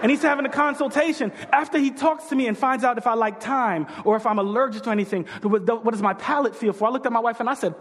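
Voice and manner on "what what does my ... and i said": ordinary talk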